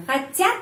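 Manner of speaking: хотят rises high on the last syllable; emphatic questioning lift on хотят; question stress. Shown as a demonstration. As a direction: ask by pushing the end of хотят up.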